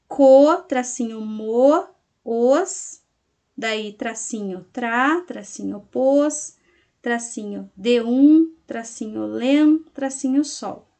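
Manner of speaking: isolated syllables with "tracinho" dropped between them; measured classroom dictation of dashed syllables. Shown as a demonstration. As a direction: dictate it piece by piece, pausing on each syllable. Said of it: "como que vocês vão colocá-lo".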